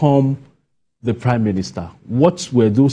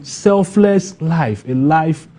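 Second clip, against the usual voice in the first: slow emphasis; 8 semitones higher, 4 dB louder, much slower than usual. Real, not acted. Emphasising the leader's virtue.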